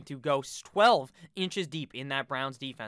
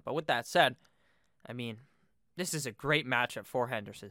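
ordinary delivery

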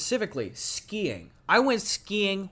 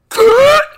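loud high strangled choke sound; mock choking gasp; 18 dB louder than usual. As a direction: imitate someone choking on water. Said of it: "they just go".

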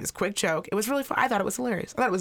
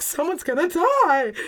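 high, loud, drawn-out mock wail; scared mother; 8 semitones higher, 5 dB louder, slower than usual. Mother scared of the motorcycles, hammed-up panicked wail. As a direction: imitate a terrified mom, stretching it out. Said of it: "She was so scared".

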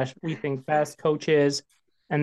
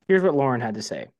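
ordinary delivery

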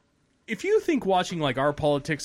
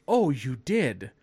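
slow, drawn-out mock understanding; lightly mocking, taking your time; sarcastic disbelief; 3 semitones lower, slower than usual. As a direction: stretch it out as if humouring a liar. Sarcastic reply to lame excuse.

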